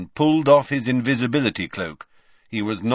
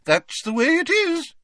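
high haughty lady voice; Fat Lady voice. Acted. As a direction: play a prim, put-out portrait lady.

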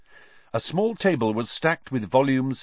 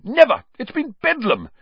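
very high, exasperated cry; frantic outburst; 6 dB louder than usual. A narrator's reading. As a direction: burst out at the end of your tether. Harassed manager rants after crying out.

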